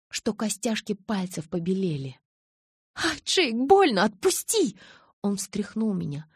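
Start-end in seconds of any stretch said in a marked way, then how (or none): 2.9-4.8 cry of pain. Acted. Bella hurt in his grip.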